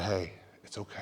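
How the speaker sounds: very soft, low comfort; soothing. Retold or imitated. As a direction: hush someone who is crying. Calming a crying girl.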